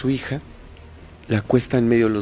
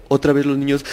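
ordinary talk, nothing marked

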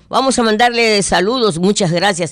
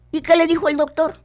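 other voice in joke; 10 semitones higher, faster than usual. Acted. Second speaker in joke voiced.

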